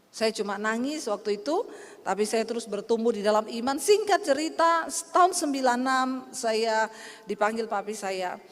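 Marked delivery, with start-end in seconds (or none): none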